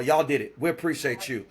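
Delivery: ordinary talk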